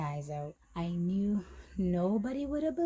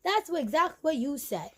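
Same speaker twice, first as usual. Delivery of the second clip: mock told-you-so; 8 semitones higher, 6 dB louder than usual. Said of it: "I'm like".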